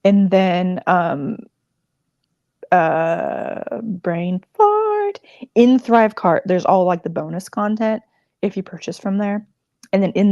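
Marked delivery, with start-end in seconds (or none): 3.8-5.2 higher, self-mocking squeak; losing her thread on a livestream, self-mocking squeak; squeaky brain fart. Real, not acted. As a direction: laugh at your own blank moment. Losing her train of thought.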